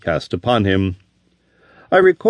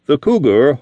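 plain reading voice

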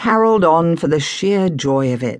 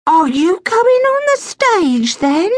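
put-on wide-eyed child; 4 dB louder than usual. Little sister asking excitedly.

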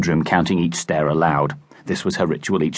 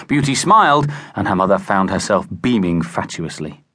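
ordinary delivery

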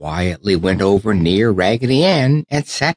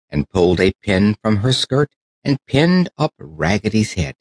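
usual speaking voice

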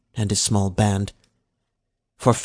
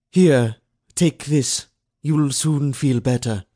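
coaxing overseer; 4 semitones higher, 3 dB louder than usual. A narrator's reading. Overseer offers the sugar.

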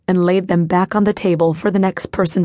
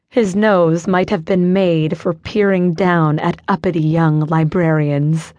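ordinary delivery, nothing marked

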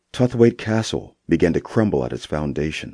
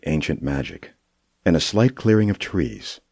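usual speaking voice

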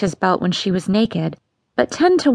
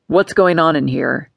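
lower, stern father asking; a stern father bursting in; Papa's demand; 5 semitones lower than usual. Acted. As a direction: demand to know what's going on. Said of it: "he asked".

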